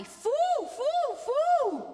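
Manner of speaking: very high owl hoots; loud, soaring falsetto owl hoot; hooty, up high. Shown as a demonstration. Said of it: "Let's do it up high".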